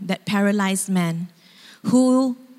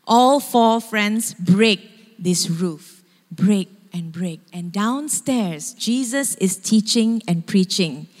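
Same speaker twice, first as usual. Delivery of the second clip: ordinary talk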